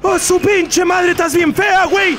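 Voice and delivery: high, strained cry; agitated insult. Real, not acted. Player telling an ugly character off, agitated high cry. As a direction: hurl it out in a high voice.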